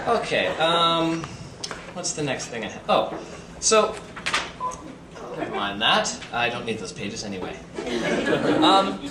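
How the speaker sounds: usual speaking voice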